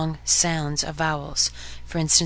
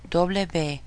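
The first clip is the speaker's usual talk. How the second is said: normal talking voice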